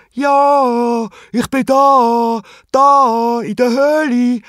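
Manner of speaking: high, loud, drawn-out happy shout; happy calling. A narrator's reading. Henry answers his friends from the cave, calling happily. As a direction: call back cheerfully from a distance. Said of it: "ruft er ganz glücklich und zufrieden".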